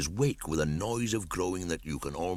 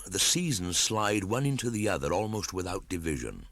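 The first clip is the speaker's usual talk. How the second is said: ordinary delivery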